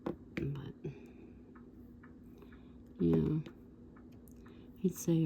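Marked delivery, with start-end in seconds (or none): none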